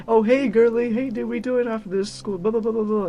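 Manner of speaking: put-on high, loud, gushing girly greeting; super outgoing popular girl. Popular girl greeting her friends after school, super outgoing. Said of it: "just super outgoing".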